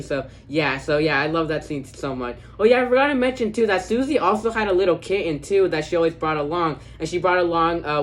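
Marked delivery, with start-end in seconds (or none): 2.6-5.4 higher, louder burst of sudden recall; sudden remembering. Real, not acted. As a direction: blurt it out as it comes back to you.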